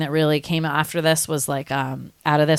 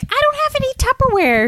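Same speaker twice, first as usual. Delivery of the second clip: very high, squeaky granny voice; hammed-up high-pitched old lady; excited old lady; faster than usual. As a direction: imitate an overexcited little granny. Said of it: "she's, like, so excited".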